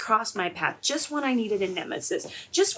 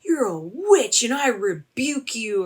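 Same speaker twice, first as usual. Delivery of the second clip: hammed-up, swooping rebuke; mock rebuke; 3 semitones higher, 6 dB louder than usual. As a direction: imitate a pastor casting out a witch. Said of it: "he's like, something like".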